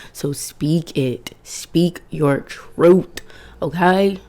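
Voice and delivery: very slow, weighted urging; slow and deliberate. Real, not acted. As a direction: drag each word to land it.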